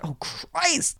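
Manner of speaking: mock-despairing, drawn-out exclamation; exasperated. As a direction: squeak it out in hammed-up exasperation. Retold exasperation at the child.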